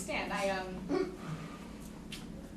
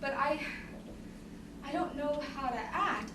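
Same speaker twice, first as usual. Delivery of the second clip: ordinary talk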